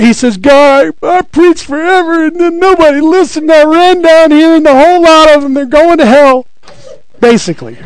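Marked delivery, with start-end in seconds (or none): 0.4-6.5 high, whiny prophet pleading with God; complaining Elijah. Acted. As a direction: whine it out like a worn-out, self-pitying prophet.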